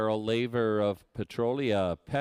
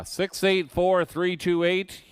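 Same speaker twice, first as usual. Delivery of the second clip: six eight four three two eight higher and louder; excited number call; 8 semitones higher, 5 dB louder, slower than usual. Acted. Repeats number to winner.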